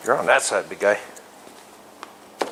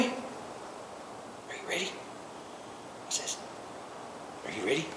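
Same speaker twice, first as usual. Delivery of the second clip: drawn-out breathy whisper, much softer; slow whisper; 20 dB quieter, slower than usual. Real, not acted. Owner teases the cat before feeding, slow whisper.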